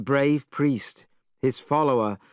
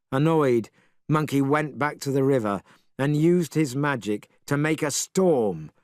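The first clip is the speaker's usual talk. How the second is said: usual speaking voice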